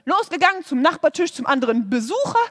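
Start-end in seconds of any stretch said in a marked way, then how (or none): none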